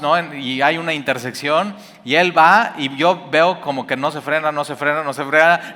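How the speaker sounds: ordinary talk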